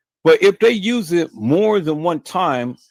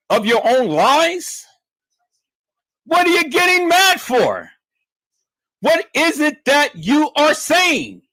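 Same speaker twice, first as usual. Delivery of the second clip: much higher and louder, ramped up; raised voice for emphasis; 12 semitones higher, 4 dB louder than usual. Real, not acted. Vlogger ramping up at his doubters, higher and louder. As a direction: hammer the point home, voice elevated high. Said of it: "now my voice raises, it elevates".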